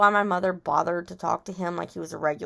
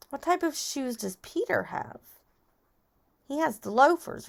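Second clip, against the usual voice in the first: usual speaking voice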